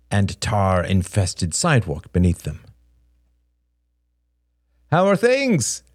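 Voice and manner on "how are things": high-pitched, hearty greeting; raised, enthusiastic question; eager cry. A narrator's reading. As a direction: cry it out brightly to a brother. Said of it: "cried Robert".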